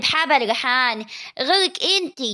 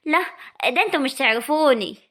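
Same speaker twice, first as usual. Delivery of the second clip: ordinary talk, nothing marked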